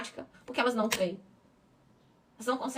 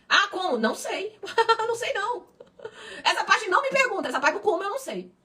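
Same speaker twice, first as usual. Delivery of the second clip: higher, laughing mock dialogue; playful laughing reply; 8 semitones higher than usual. Pastor acting out being asked how, laughing. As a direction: toss off the answer with a laugh.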